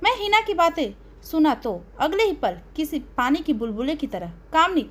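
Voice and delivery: plain reading voice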